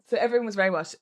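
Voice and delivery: ordinary talk, nothing marked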